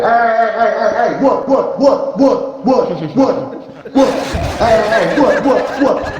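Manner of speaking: short, repetitive dog-bark exchange; two dogs barking. Retold or imitated. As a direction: bark 'hey' and 'what' like neighbouring dogs. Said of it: "a dog across the street, then they start talking".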